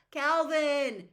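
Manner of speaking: loud high shout through glass. Acted. Meg to Calvin behind glass, a loud shout. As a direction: call to him across the wall. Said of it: "she shouted".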